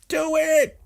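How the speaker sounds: very high squealed command; urging. Retold or imitated. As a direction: push a friend on in mock urgency. Voicing the friends' urging sign.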